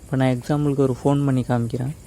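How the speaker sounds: normal talking voice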